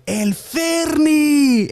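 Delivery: high, drawn-out mock shout; rowdy nickname call. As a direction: holler it like cousins greeting him, stretched long. Cousins hail him by nickname.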